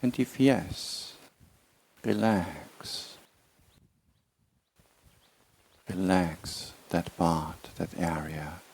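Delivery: slow, measured meditation guidance with long silences; unhurried body-scan voice, words drawn out; guided meditation. Real, not acted. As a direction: ease them slowly into relaxation, phrase by phrase. Leads a body relaxation meditation.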